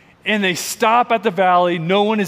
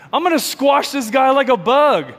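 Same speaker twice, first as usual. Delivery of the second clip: hint of a boastful giant; 6 semitones higher, 4 dB louder than usual. Giant's thought voiced.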